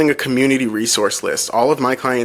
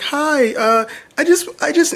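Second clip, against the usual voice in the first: imitating a tearful caller, far higher and broken off; choked-up nurse. Zach imitating the crying nurse on the phone, high and halting. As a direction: mimic someone too emotional to get words out. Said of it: "and she's in tears".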